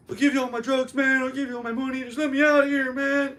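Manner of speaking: begging panicky guy. Retold or imitated. Crazy-looking detainee begging to be let out, high frantic pleading.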